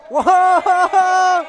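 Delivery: sudden loud whoa; surprised shout. Real, not acted. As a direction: gasp it out loud. Reacting to a knockdown.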